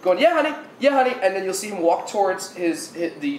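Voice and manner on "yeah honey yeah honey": higher-pitched, offhand phone chat; lightly voiced guy calling his honey; man on the phone. Ferry man on his cell phone to his honey, casually.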